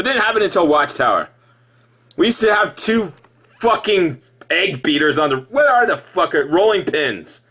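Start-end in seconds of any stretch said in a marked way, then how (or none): none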